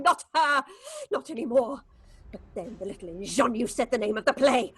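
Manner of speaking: ordinary talk, nothing marked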